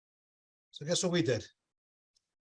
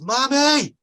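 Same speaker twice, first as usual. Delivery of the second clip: put-on high loud child's yell for mom; kid yelling; 10 semitones higher, 9 dB louder than usual. Little son yelling through the back door for mommy. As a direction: call out like a little boy hollering. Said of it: "he yells".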